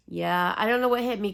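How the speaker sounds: normal talking voice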